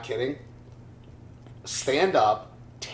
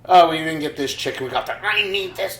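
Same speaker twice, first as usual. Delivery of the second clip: high, loud mock complaint; mock fussing; 5 semitones higher, 6 dB louder than usual. Imitating someone upset over a chicken order, mock fussing. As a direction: whip it up into a high-pitched fuss.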